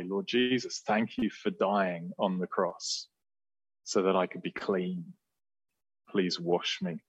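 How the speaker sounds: soft, hushed prayer voice; quiet prayer. Acted. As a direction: pray it gently, almost under the breath.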